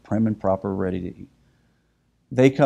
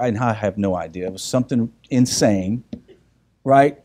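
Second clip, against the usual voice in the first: ordinary talk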